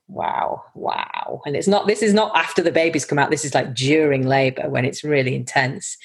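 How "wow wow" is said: breathless whispered wow; awed whisper. Shown as a demonstration. Midwife to colleague at a birth, awed whisper. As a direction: whisper it, struck with wonder. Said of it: "we do this".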